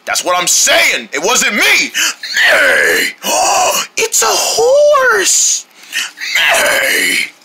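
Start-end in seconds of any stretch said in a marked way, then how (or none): 2.4-3.2 low rough horse neigh; horse whinny. Acted. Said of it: "your horse impression is terrible". 3.2-5.7 surprised. Acted. Gasping at seeing a horse. 6.4-7.3 horse whinny. Acted. Horse noise after gasp.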